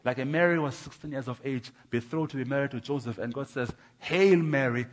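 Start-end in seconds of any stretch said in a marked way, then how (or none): 4.0-4.9 loud, ringing salutation; proclaimed greeting. Acted. God greeting young Mary, loudly proclaimed. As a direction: hail someone with a strong, raised voice.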